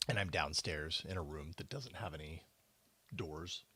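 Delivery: hushed so the house sleeps; quiet at night. Real, not acted. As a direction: keep it down, everyone's asleep. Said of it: "keep myself just a little on the quiet side".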